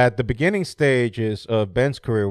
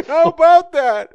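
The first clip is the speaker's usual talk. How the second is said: high, slow, louder smug squeak; mock high voice; 8 dB louder than usual. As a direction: gloat in a silly high voice. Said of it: "They go".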